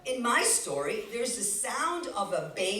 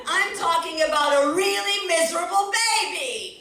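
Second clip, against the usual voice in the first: higher and louder, pushing the crowd; raised, urging voice; emphatic; 8 semitones higher, 9 dB louder than usual. Real, not acted. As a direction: egg them on for more. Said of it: "such a polite baby".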